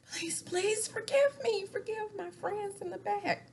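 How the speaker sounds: high, mock-pleading apology; lightly voiced pleading hostess. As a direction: ham up a begging apology. [name] apologising on stage.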